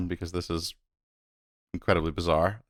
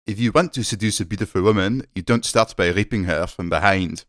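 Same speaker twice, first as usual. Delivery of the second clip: put-on French accent. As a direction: read it like a smooth French CEO in a French accent. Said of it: "reading this quote in a French accent".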